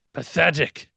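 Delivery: sneering. Acted. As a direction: spit the word out in disgust. King sneers.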